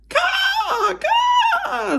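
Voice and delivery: high, loud crow caw. Acted. The Pellegrina crow answering Edward with a caw. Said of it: "said the Pellegrina crow".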